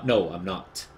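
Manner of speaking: ordinary talk, nothing marked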